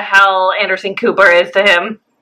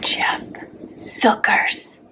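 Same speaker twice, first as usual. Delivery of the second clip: whispering; 9 dB quieter, much slower than usual. Acted. Hushed reveal of affair.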